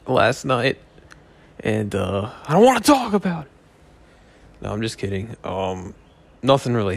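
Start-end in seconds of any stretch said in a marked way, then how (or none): 2.5-3.5 mock dramatic. Fake dramatic refusal.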